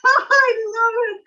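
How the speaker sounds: bursting into a high delighted laugh. Real, not acted. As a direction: laugh through the words with delight. Delighted laugh over telenovela.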